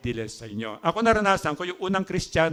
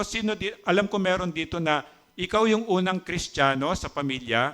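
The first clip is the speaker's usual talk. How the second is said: ordinary delivery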